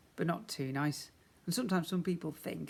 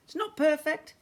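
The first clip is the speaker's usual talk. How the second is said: high, wry shopkeeper; 12 semitones higher, 7 dB louder than usual. A narrator's reading. Shopkeeper to Mister Ben, high and wry. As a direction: tease a little, pitched up. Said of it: "said the shopkeeper".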